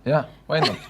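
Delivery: real amused laugh breaking into the words. Real, not acted. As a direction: answer through a burst of laughter. Shared laughter at a teasing remark.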